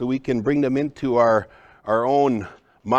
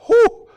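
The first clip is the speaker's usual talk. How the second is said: whew of relief; 12 dB louder than usual. Real, not acted. Exhale after staged yelling.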